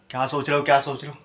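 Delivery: low mock repeated questioning; low-voiced nagging imitation; pestering partner. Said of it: "घड़ी घड़ी पूछती रहेगी".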